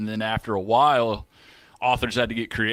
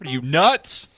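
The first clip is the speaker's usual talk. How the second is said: mock indignation; 4 semitones higher, 4 dB louder, slower than usual. Mock outburst continues.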